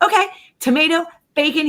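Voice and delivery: high-pitched, patient-sounding counter-offer; coaxing. Retold or imitated. Mom bargaining with a picky six-year-old, coaxing.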